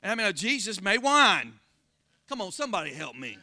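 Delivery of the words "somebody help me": normal talking voice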